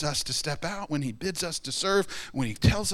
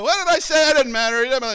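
high, loud, careless mock voice; mock careless blabbermouth; 11 semitones higher, 7 dB louder, faster than usual. Preacher mimicking a blabbermouth shrugging off words, high and loud. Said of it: "and you go".

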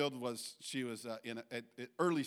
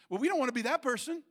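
higher, louder and quicker; emphatic warning; 9 semitones higher, 10 dB louder, faster than usual. Real, not acted. Preacher warns congregation not to be that, loud and fast. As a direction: press it home to the room.

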